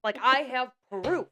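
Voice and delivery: slow, insistent stress on "I have proof"; emphatic insistence. Retold or imitated. Acts out guest insisting on proof.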